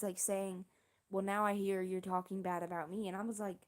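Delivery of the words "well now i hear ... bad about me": usual speaking voice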